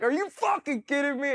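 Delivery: high, outraged disbelief; shouting in disbelief at the surgeon; freaking out. Retold or imitated. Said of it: "I start just freaking out".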